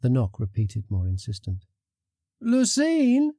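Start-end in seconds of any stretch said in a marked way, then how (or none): 2.4-3.3 high-pitched call, rising at the end. A narrator's reading. Friend outside calls to Lucine, calling out. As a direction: call a name through a door. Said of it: "a voice called from outside".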